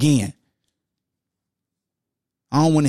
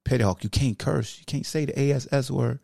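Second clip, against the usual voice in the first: a calm voice telling him off for cursing; scolding voice; 7 semitones lower, 5 dB quieter than usual. Pretend. The clean voice scolding Petty Hawk for cursing, low and soft. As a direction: lower and softer, chiding.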